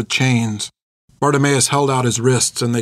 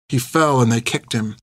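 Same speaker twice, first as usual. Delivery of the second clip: plain reading voice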